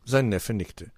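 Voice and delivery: plain reading voice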